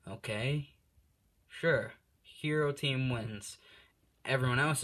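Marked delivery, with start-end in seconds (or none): 0.0-3.6 slow, puzzled declaration; confused announcer. Retold or imitated. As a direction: announce it slowly, baffled. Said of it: "All Might's like".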